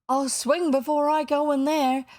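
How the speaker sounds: high, loud, stubborn refusal; dogged. A narrator's reading. Starkey defies Hook with the crew behind him, doggedly. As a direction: dig in and refuse outright. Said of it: "replied Starkey doggedly".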